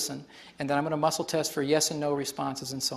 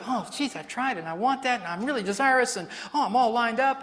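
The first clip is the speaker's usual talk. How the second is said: mock eager voice; 6 semitones higher than usual. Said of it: "you think".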